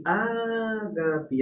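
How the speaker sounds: "ágape" with the first Á drawn out; slow "ÁÁÁ-gape", teacher's demo; stress on antepenultimate syllable. Shown as a demonstration. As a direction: stretch the opening vowel of "ágape". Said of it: "A sílaba tônica é a antepenúltima".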